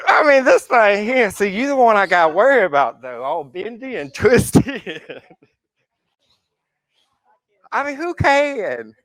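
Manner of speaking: high, laughing teasing; high laughing banter at the bendy student; laughing tease. Real, not acted. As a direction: tease her through laughter.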